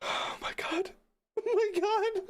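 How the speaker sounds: whispered laugh, almost crying; breathless laugh. Real, not acted. Gamer recovering from a scare, a breathless whispered laugh. After calming down.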